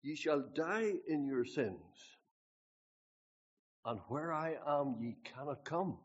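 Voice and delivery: slow, loud solemn proclamation; slow, solemn scripture voice; Jesus' words. Retold or imitated. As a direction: pronounce it like a stern verdict. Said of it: "Here's what Jesus said".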